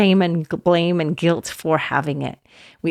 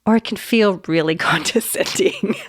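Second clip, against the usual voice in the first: usual speaking voice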